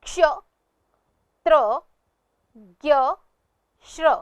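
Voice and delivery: "क्ष, त्र, ज्ञ, श्र" one at a time, with pauses; pronunciation drill. Shown as a demonstration. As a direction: model each conjunct "क्ष, त्र, ज्ञ, श्र" as a separate syllable. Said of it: "उच्चारण करते हैं". ("क्ष, त्र, ज्ञ, श्र" का रुक-रुककर स्पष्ट उच्चारण)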